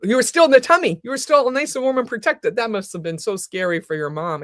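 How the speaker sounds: ordinary talk, nothing marked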